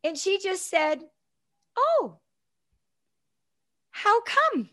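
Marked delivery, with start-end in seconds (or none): 1.8-4.7 gentle, much higher, kindly asked; kindest voice. Retold or imitated. Mom gently asks the principal in her kindest voice. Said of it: "her kindest voice".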